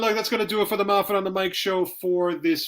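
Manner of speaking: a lightly lifted podcast host sign-off; show sign-off. Acted. Host closing his weekly show, light announcer sign-off. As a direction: wrap up the episode like a host.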